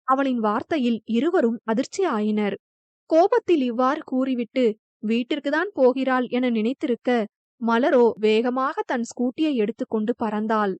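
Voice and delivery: plain reading voice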